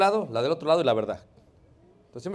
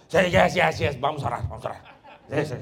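angry man agreeing; 2 semitones lower, 5 dB louder than usual. Retold or imitated. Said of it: "hasta el más".

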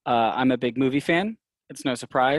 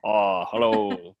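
put-on spooky laugh and greeting; ghostly cackle, then a low hello; fake spooky laugh; 3 semitones lower than usual. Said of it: "That is not my real laugh".

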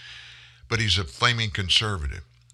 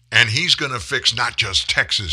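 ordinary talk

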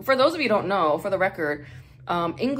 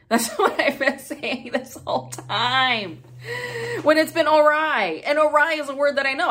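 laughing; 9 semitones higher, 5 dB louder than usual. Real, not acted. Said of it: "laughing at own mistake".

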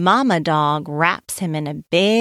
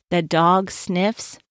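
ordinary delivery, nothing marked

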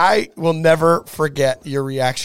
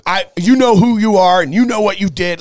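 angry pastor; 5 semitones higher, 5 dB louder than usual. Retold or imitated. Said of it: "And he's like".

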